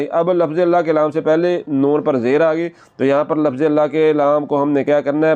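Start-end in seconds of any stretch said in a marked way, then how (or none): none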